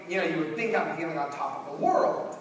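usual speaking voice

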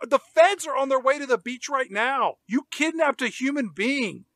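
high-pitched, urgent mock protest; exasperated outburst. Film critic protesting to the characters about the kidnapping, exasperated outburst. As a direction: raise the pitch in comic alarm, punching the last word.

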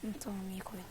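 unvoiced whisper to himself. Real, not acted. Gamer whispering to himself while playing. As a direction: breathe it under your breath.

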